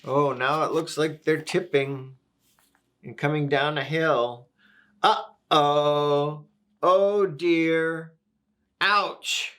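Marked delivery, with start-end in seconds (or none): 5.0-9.5 raised, drawn-out storybook dismay; mildly alarmed read-aloud of a tumble; acted alarm. A narrator's reading. Reader voices animals tipping down a hill, acted alarm.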